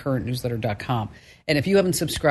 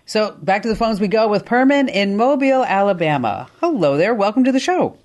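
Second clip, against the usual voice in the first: higher, louder upbeat radio intro; bright redo; 6 semitones higher, 4 dB louder than usual. Shown as a demonstration. Host redoing a caller intro for a clean edit, upbeat. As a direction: sell the welcome with more energy. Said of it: "let me do that again so this way we get a nice little clean edit".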